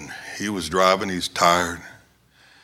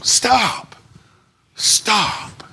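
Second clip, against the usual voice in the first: high, drawn-out cry fading off; imitated high holler; retold screaming; 11 semitones higher than usual. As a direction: act out men hollering a warning. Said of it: "he was still screaming".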